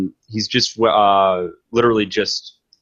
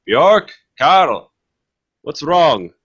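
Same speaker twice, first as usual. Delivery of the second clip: calling out; 7 semitones higher, 5 dB louder, slower than usual. Acted. Voice calling from the forest.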